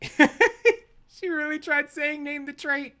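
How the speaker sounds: high, squeaky laughing disbelief; incredulous laughter. Real, not acted. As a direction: squeeze the words out through laughter. Laughs at opponent's claim.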